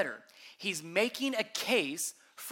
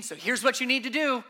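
louder, brisk mock self-help tip; preacher mocking a how-to guide, louder and faster; 5 semitones higher, 5 dB louder than usual. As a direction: rattle off a pushy to-do list. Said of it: "He's not giving".

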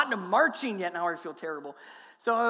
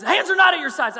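loud, much higher yelled correction; drill sergeant yell; 8 semitones higher, 10 dB louder than usual. Retold or imitated. Drill sergeant yelling at the pastor's hands, loud. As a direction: yell it at the recruit. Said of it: "treat me like you're a drill sergeant".

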